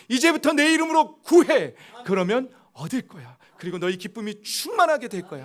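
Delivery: loud, high promise from the Lord; 주님의 약속을 힘차게 선포하듯; the Lord's voice. Acted. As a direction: proclaim the promise with fervent force. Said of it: "말씀하시는 거예요".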